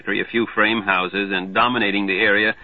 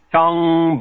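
pinball tong; 7 semitones higher, 4 dB louder than usual. Acted. Pinball sound imitated.

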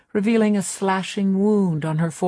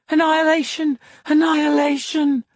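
high, frantic cries; injured woman screaming the word over and over; repeated shriek; 9 semitones higher, 3 dB louder than usual. A narrator's reading. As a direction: cry it like a wounded bird. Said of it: "like the cry of a bird with a broken wing".

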